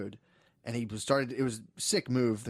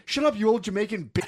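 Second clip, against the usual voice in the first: high, loud mock outburst; imitated yelling; 9 semitones higher, 7 dB louder than usual. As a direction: shout it like an enraged kid. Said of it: "Joshua was yelling at the fu-, the Jamaicans".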